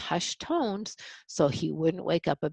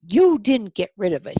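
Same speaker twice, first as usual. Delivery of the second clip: loud, higher angry shout; 4 semitones higher, 9 dB louder than usual. A narrator's reading. Frizzle discovering the baby ghost, loud angry shout. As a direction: let him explode at Gus. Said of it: "he shouted".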